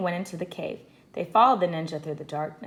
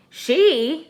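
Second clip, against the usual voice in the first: surprised she; 10 semitones higher, 7 dB louder than usual. A narrator's reading. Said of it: "said Jack and Annie together".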